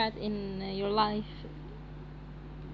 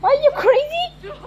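startled yell; 10 semitones higher, 11 dB louder than usual. Real, not acted. Startled by the game.

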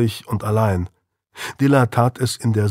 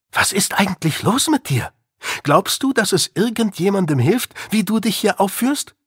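higher, pleading woman's voice; worried mother Maren; 9 semitones higher than usual. Acted. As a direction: confront him at the door, worried.

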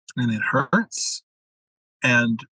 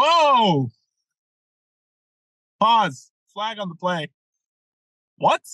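sudden high alarmed "whoa", then a halt; startled stop; 6 semitones higher, slower than usual. Retold or imitated. Coach stopping his client mid-sentence, startled and high. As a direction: slam the brakes on the conversation, higher.

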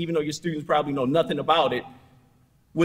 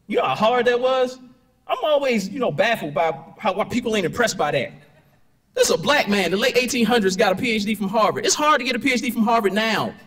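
excited; 6 semitones higher, 4 dB louder than usual. Real, not acted. Lecturer marvels at Du Bois.

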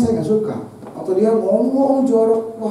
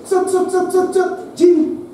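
imitated spitting; 7 semitones higher, slower than usual. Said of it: "Dia habis ngomong nih ya".

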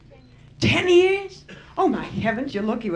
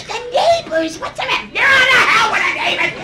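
much higher, louder panicked shouting; fight yell; 12 semitones higher, 5 dB louder than usual. Retold or imitated. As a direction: shout over him in a screaming match. Acted fight, wife and husband.

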